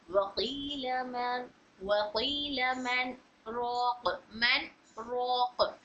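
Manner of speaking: slow drawn-out Quran recitation, stopping on रोक; Quranic recitation demo. Shown as a demonstration. Teacher reciting a verse to students, slow and drawn out. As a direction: recite वकील मन रोक slowly, with a light halt before रोक. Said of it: "इस तरह से पढ़ रहे थे ना".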